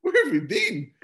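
very high, loud incredulous echo; mock outrage. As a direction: throw the question back in a high, hammed-up cry. Echoes co-host in mock outrage.

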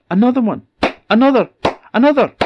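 woman claps out coin after coin, growing excited; building excitement. Acted. Coins keep falling.

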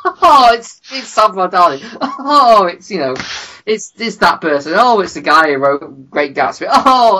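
high, swooping gasps of recognition; mock gushing. Audiences spot a famous name, mock-thrilled. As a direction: gush like a starstruck filmgoer.

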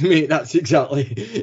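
laughing through mate, mate, high and drawn out; amused exasperated reply to a fan. Retold or imitated. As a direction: chuckle it out in disbelief.

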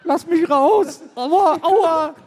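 high, loud trapped angel yelp; high squeaky cry. Pretend.

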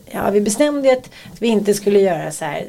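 ordinary talk

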